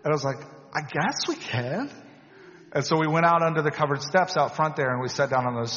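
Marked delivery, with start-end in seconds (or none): 0.7-1.9 slow, higher, questioning concession; retold hesitant agreement. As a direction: give in unsurely, lifting the ending like a question.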